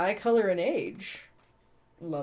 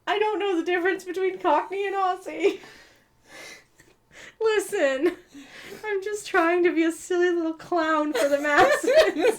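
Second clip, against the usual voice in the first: very high, loud, silly plea; mock-plaintive squeal; 11 semitones higher, 4 dB louder than usual. Reader defends her accents to co-host, high mock-plaintive voice. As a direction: plead in a high comic whine, breaking into laughter.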